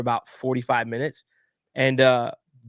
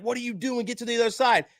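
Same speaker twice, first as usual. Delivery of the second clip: retold scolding in a raised pitch; alarmed scolding; 9 semitones higher than usual. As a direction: imitate flustered staff ordering someone away. Retold staff scolding him in delivery room.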